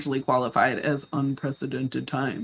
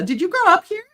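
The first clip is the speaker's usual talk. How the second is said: much higher, nosy small-town voice; mock townsperson; 6 dB louder than usual. Locals quizzing a newcomer, high mock voice. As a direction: sing it out like a curious neighbor. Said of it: "I always get the".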